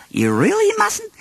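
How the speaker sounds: high, gently firm motherly voice; a mother's kind but firm warning; firm Kanga. Acted. Kanga warns bouncing Tigger, high and gently firm. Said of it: "Kanga said firmly".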